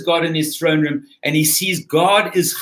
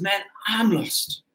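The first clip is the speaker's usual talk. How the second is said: softer and slower, the word "lost" sinking as it dawns; quiet realization; 4 semitones higher, 6 dB quieter, slower than usual. Retold or imitated. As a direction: murmur it as a man seeing his own ruin. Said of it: "now he realizes".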